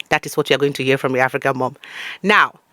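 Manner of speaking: usual speaking voice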